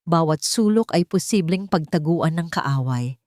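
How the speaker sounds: ordinary delivery, nothing marked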